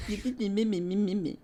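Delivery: babbled mimicry of a friend; mocking imitation. Mocking how the friend sounds, teasing babble. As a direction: parrot them in mocking nonsense syllables. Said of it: "That's what you sound like".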